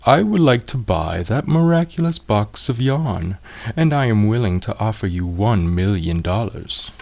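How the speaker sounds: lightly voiced grand nobleman making an offer; haughty archduke. A narrator's reading. Archduke offers to buy yarn.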